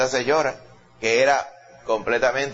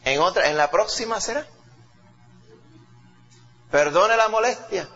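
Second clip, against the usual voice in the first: higher, meek mock apology; lightly mocked humble woman; 7 semitones higher than usual. Woman mock-apologizing to Jesus, light high voice. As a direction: play her as mock-resigned and polite. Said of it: "Y ella que le dijo".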